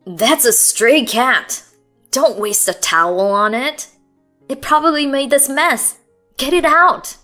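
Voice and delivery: annoyed and dismissive, building; irritated. Acted. Husband ordering wife to throw the cat out, irritated. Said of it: "Sam's irritated voice".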